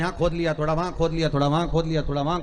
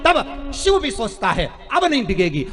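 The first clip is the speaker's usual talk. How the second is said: louder, insistent preaching cadence on Shankar ही; fervent preaching; 4 semitones higher, 6 dB louder than usual. Acted. Preacher telling devotees only Shankar provides, in a loud, insistent refrain. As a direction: hammer each Shankar ही like a refrain.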